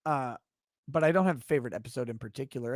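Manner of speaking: normal talking voice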